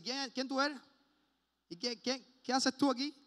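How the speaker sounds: pregunta altanera, subiendo la voz; orgulloso, retador. Acted. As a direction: cuestiona con orgullo herido, más agudo. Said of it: "a veces personas orgullosas". (reto altanero)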